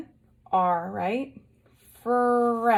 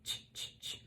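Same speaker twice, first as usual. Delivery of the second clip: whispered ch; 19 dB quieter than usual. Shown as a demonstration. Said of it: "what sound does a C H make?".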